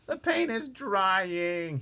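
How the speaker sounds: high, loud, slow moan. Acted. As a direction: drag out the lament. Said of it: "he moaned".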